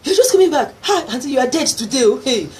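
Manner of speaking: high, loud and laughing warning; excited warning. Retold or imitated. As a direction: shout the bad news half laughing. Sister's shocked warning.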